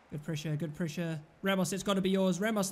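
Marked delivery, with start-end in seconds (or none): none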